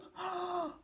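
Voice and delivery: high, soft lovestruck gasp; startled gasp. Acted. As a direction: gasp as if struck by love. Giant sees mum.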